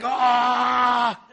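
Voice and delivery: high, drawn-out mock scream; exasperated cry. Preacher shows people losing it, exasperated cry. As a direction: act out a frustrated wail. Acts being overwhelmed in that place.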